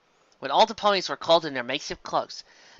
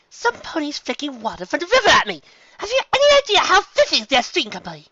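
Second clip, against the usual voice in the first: high, fussy and offended; a fine lady scandalised by filth; high-pitched haughty complaint; prissy Rarity; 13 semitones higher, 6 dB louder than usual. Acted. Rarity complains about the water.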